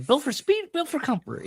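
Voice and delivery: high Vince Vaughn catchphrase; Vince Vaughn impression. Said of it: "he's got the".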